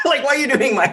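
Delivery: laughing while asking; high laughing protest. Real, not acted. Laughing at friend's voice.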